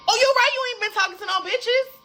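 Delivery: very high, loud mocking echo; sarcastic agreement. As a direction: throw his denial back at him, voice way up. Sarcastically throws his denial back.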